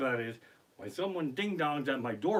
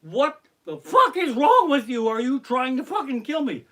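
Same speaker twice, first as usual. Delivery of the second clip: high, loud, outraged shouting; full-volume indignant rant; angry yell; 9 semitones higher, 10 dB louder than usual. Acted. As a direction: yell it at careless strangers. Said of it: "run out and yell at 'em".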